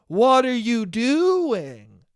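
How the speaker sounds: "What are you doing?" stretched out very slowly, each word dragged in disbelief; drawn-out disbelief. Real, not acted. Still fuming about the friend, dragging "What are you doing?" out slowly in disbelief.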